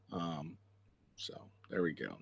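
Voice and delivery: whispering. Real, not acted. Aside while cueing clip.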